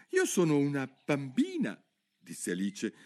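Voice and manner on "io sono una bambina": doubtful. Acted. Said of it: "piuttosto dubbiosa".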